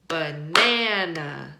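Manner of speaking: slow "banana" with the middle NA stretched and strong; second-syllable stress. Shown as a demonstration. Teacher showing word stress, slow ba-NA-na for "banana". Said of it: "It sounds like this".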